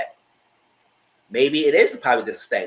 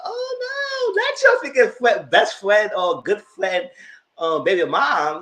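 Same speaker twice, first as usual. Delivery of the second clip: high then slow, louder dismay; mock outraged wail; 9 semitones higher, 5 dB louder, much slower than usual. Reacting to inviting baby mamas, high and outraged.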